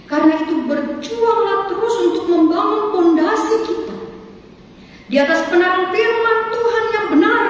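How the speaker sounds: rally the congregation from the pulpit; forceful preaching. Acted.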